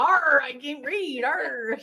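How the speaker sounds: slow playful pirate talk; hammed-up pirate, slow. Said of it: "You're like a pirate".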